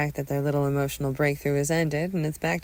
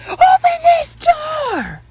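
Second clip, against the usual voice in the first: very high, drawn-out shriek; screamed demand; 12 dB louder, much slower than usual. Acted. Mother demands son open door, full hysterical scream. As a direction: scream each word slowly at the closed door.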